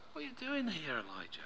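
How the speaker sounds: soft, high start falling away to a hush; still small voice. Acted. God coaxes Elijah instead of scolding him, softly. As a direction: coax gently, fading on the name. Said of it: "The still small voice".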